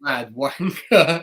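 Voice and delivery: slow, laughing "mad wanker"; laughing self-mockery. Real, not acted. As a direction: laugh at your own cockiness. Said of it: "swaggering off like some".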